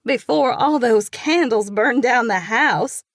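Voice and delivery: loud, teasing high voice; joking. A narrator's reading. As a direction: tease them playfully. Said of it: "Pen jokes".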